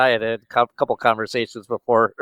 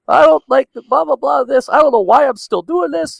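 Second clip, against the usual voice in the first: much higher, put-on griping voice; mock complaining; 13 semitones higher than usual. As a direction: imitate a friend's stream of complaints in a high voice. Voicing friend's complaining messages.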